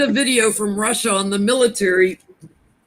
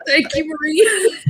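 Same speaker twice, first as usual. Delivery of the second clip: after laughing hard; 9 semitones higher than usual. Real, not acted.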